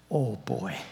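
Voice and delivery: ordinary talk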